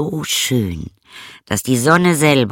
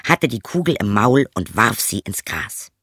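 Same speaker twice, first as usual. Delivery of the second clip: ordinary delivery, nothing marked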